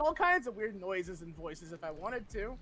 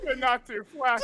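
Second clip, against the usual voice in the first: laughing; 4 semitones higher, 9 dB louder than usual. Retold or imitated. Quoting game line, laughing.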